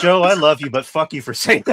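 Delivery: loud, high protest through laughter; laughing outburst. Real, not acted. Host to Joe over the sad line, laughing protest. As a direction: curse a friend affectionately, loud and laughing.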